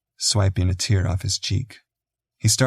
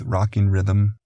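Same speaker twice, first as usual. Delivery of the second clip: ordinary delivery, nothing marked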